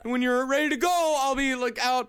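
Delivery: whiny nice guy talking fast; pushy guy voice. Retold or imitated. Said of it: "He's like".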